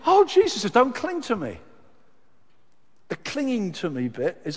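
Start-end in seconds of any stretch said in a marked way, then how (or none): 0.1-1.6 very high, loud cry of joy; ecstatic cry. Acted. Mary at the tomb meeting Jesus, crying out with joy. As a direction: burst out overjoyed. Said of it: "And then she goes".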